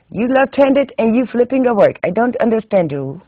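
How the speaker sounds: put-on high, loud scolding old-school knitter; bossy exclamation at a pupil's mistake; scolding Swedish teacher. Swedish teacher puzzled at the narrator.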